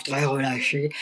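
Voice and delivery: very low, slack and quiet; vocal fry attempt. Shown as a demonstration. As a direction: let the voice sink low and relax completely. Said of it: "C'est une voix très grave".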